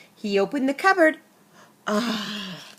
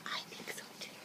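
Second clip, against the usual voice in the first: hushed conspiratorial whisper; 25 dB quieter, much faster than usual. Acted. Reader whispers to the children, a hushed aside. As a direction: let the children in on a secret. Reader shares a secret with listeners.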